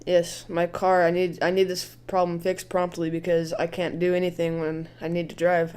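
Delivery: normal talking voice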